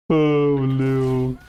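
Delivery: sharp gasp, then a high "oh no"; dismayed gasp. Real, not acted. Coach reacting to a replay, high gasping dismay.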